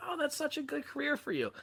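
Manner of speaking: high, cooing imitation of a wife; mock-gushing spouse's praise; delighted wife. Acting out his delighted wife.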